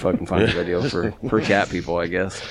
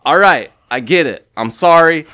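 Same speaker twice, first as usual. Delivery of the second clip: slow, raised-voice mock surrender; drawn-out imitation of apologizing, getting louder; retold apology; 6 semitones higher, 6 dB louder, slower than usual. Man turning round to the cat, exasperated mock apology. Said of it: "and he goes".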